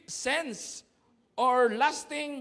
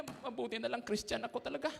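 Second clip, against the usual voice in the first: much softer, holding back fury; restrained mutter; 8 dB quieter than usual. Retold or imitated. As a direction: mutter it through held-in anger. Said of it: "Sabi niya".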